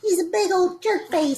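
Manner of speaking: high-pitched playground name-calling; mock childish insult. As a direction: pretend to be an indignant little name-caller. Mocking the game's childish insults.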